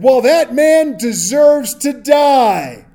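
David furiously condemning the man in the story, loud and slow; outraged verdict. Retold or imitated. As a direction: bellow the verdict in righteous outrage.

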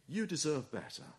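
very soft, insinuating murmur; hushed inner voice; little tempting voice. Acted. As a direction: slip it in quietly, like a tempter. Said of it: "is the little voice which says".

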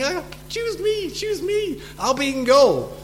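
high-pitched, eager begging; pleading schoolchild. Acted. Last child left when picking teams, desperate high-pitched pleading. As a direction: beg to be picked in a high child's voice.

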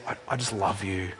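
very soft, low comforting words; tender parent. Retold or imitated. Parent comforting an angry child, very soft and tender. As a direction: hush an angry child with love. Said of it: "a parent comes and says".

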